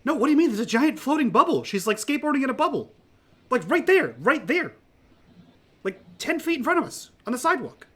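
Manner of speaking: high, loud, incredulous protest; worked-up character arguing in a high voice; frantic insisting. Acted. Mitch insists the bubble is there.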